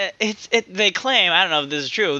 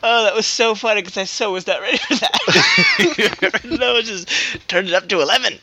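can barely talk for laughing; 5 semitones higher than usual. Real, not acted. Still laughing after the bit.

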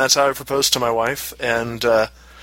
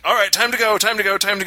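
urgent hustling; 8 semitones higher than usual. Retold or imitated.

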